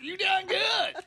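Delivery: hammed-up prospector shout; grizzled prospector. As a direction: holler like a wild old miner. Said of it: "impression of the old grizzled prospector".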